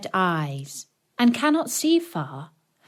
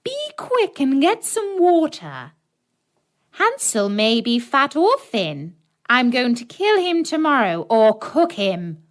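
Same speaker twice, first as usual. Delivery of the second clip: high, louder witch commanding; impatient witch; 5 semitones higher, 4 dB louder than usual. A narrator's reading. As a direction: hurry the girl, menacing. Witch orders Gretel.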